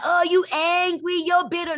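high, whiny mock voice of church critics. Preacher mocking critics who call her bitter. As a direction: sneer it like a scornful churchgoer. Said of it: "they're like".

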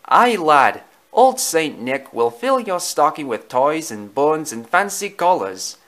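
loud, hearty Scottish character voice; thick Scottish accent. Acted. Jock joins in about Saint Nick, loud Scottish accent. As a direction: put on a Scottish accent and call it out. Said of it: "a voice with a thick Scottish accent".

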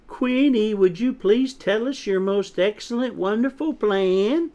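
high, exaggerated sweet pleading; insincerely nice. A narrator's reading. Chick made to ask Quinny nicely, mock-sweet and high. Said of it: "Say it like you mean it".

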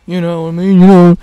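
very loud, close-up mic voice; right on the mic. Real, not acted. Vlogger crowding the mic at viewers, loud close-mic voice. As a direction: lean right into the mic, booming. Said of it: "I just got real close to the mic".

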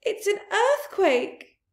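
high, slow alarmed cry. Acted. Missus Fox crying out to her family in alarm. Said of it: "cried Missus Fox".

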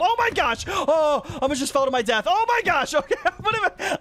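high-pitched gamer panic; panicked yelps breaking into a laugh; freaking out. Real, not acted. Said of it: "I'm freaking out a little too much".

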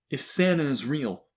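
loud, high schoolyard challenge; shouted. A narrator's reading. Bully standing on chair challenges believer, shouting. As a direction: call it out across a classroom. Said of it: "he shouted".

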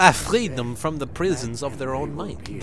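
put-on French into Russian into German accent; a muddled French-Russian-German accent, low and quiet; bad French-Russian-German accent mocking the villain; mixed European accent. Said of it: "I don't even know what accent that was".

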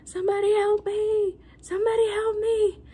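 high, strained imitation of an elderly man's yell; imitating an old man yelling. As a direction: play the panicked old man calling out from the next room. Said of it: "he was yelling".

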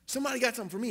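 very high voice imitating amazed gratitude; overcome with disbelief. Man receiving a gift, imitated in high-pitched amazement. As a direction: act out a man who can't believe it. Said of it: "you could see it on his face".

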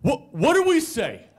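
high, loud, stammering outrage; acted indignant interrogation; exasperated demand. Berates roommate over code word.

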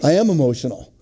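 subdued, matter-of-fact confession; sheepish admission. Acted. Husband concedes his wife was right, in a subdued admission. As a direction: drop the protest and own up.

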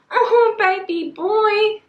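high, drawn-out cooing; hammed-up fangirl gushing; playful swoon. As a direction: coo it slowly, far up in pitch. Swooning over a favourite character.

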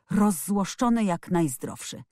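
ordinary delivery, nothing marked

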